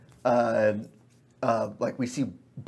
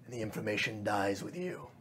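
cold quiet threat; 2 semitones lower, 10 dB quieter than usual. Acted. Fae after crushing pixie.

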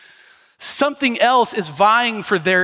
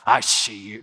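judgmental disgust; 8 semitones lower, slower than usual. Shown as a demonstration. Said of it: "No, that's not that".

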